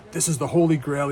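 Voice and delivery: lightly acted thrilled buyer; imitated excited customer. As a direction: play a pumped-up happy customer. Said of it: "He was just so excited".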